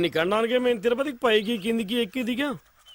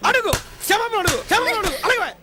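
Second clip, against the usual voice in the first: higher, shouted order to apologise; angry demand; 9 semitones higher than usual. Acted. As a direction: bark the demand at her, pitch up. Father forcing daughter to apologise. (కోపంగా అరుస్తూ ఆజ్ఞ)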